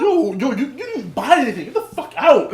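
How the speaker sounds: half-laughing mock anger; indignant telling-off. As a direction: order them out in exasperation. Acted quarrel with loitering man.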